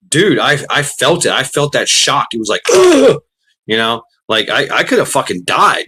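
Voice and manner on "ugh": sudden high pained grunt. Retold or imitated. Retelling the fire ant attack, a sharp high grunt. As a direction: yelp out as the bites hit. Retold ant bite shock.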